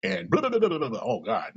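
usual speaking voice

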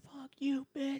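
high whispered mock shout; hushed imitation of someone yelling outside; imitated distant yelling. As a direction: whisper it like a far-off scream.

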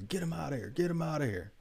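a second, milder high-pitched mock yell; retold shout. Recalling the angry patron's demand, mild mock yell. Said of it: "screaming out the top of his lungs".

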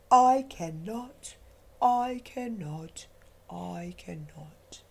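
put-on tired old engine trailing into a whisper; weary old engine. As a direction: sigh it out, worn to the bone. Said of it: "the rusty old engine sighed".

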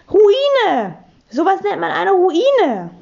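hoher, lauter Kinderruf; gebrüllt. A narrator's reading. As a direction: triumphierend hoch herausbrüllen. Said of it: "Hugo ist aufgesprungen und hat gebrüllt". (aufgeregt gebrüllte Antwort)

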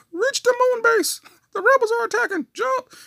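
very high, loud barked orders; mock drill-sergeant shout. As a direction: yell at recruits in a hammed-up voice. Mock drill sergeant during play battle.

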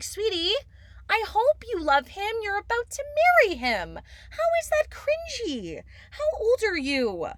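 high, sassy big-sister voice. Retold or imitated. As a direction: talk down to her sweetly. Sassy reply to Colleen.

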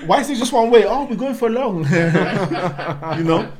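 high, loud laughing joke. Acted. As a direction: cackle through it. Laughing joke about deportation.

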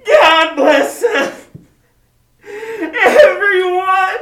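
put-on high, loud, very slow little-boy voice, breaking into a laugh; Tiny Tim. Closing the radio play as the little boy, slow and high. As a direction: stretch it out sweetly like a small sick boy. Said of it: "as Tiny Tim observed".

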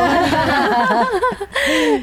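high-pitched, sustained laugh; laughter. Real, not acted. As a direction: burst into a long, high laugh. Amused reaction while retelling listening.

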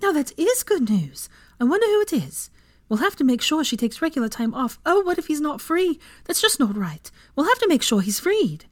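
high, excited rambling; bubbly, eager, high-pitched chatter; joyful squeal. A narrator's reading. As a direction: gush with delight, voice leaping up. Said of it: "Hermione squealed and clapped her hands in joy".

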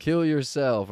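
high, loud mock heckle; heckler's shout. Audience member heckling a comic, shouted out. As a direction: call it out like a rowdy audience member. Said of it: "somebody would shout out".

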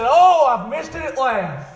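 loud, drawn-out preached lament; retold crying out. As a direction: cry it slowly as a lost man. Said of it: "This man cried out".

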